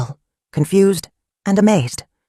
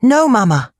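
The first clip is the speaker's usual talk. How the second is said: high, louder defiant no; 7 semitones higher, 4 dB louder than usual. A narrator's reading. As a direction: stand up to Mama, firmly. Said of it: "faced her bravely".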